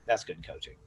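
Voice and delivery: understated, quiet wrap-up; soft closing repeat. Real, not acted. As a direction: let "That's good coaching" settle quietly as a conclusion. Repeats the praise to close.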